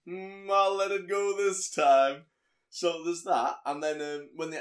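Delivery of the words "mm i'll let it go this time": smug let-off. Retold or imitated. Vermin letting it slide.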